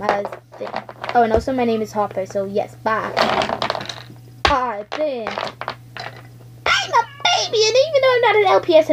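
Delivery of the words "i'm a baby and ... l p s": put-on very high laughing baby voice. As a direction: giggle like a baby. Baby toy giggles.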